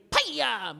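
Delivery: sudden cracking mouth-made gunshot; bullet sound. Retold or imitated. Comic mimics her hit to his head, bullet-crack sound. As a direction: imitate a slap landing like a shot. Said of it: "Sound like a bullet".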